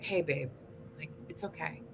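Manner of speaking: soft, hushed reassurance, fading to a whisper; gentle self-talk. Retold or imitated. Talking herself down kindly, soft and hushed. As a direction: soothe yourself like a hurt friend, ending in a whisper.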